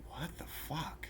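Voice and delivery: whispered, drawn-out incredulous reaction; hushed disbelief. Retold or imitated. Vlogger recalls getting no response to his comment, hushed disbelief.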